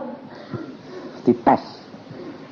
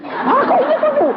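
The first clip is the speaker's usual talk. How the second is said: high, laughing reply; laughing retort. Retold or imitated. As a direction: laugh while answering the student. (sambil tertawa, suara tinggi)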